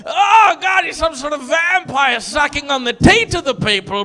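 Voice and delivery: hammed-up furious shouting; mock angry rant. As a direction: rant like an angry student protester. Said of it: "we were like".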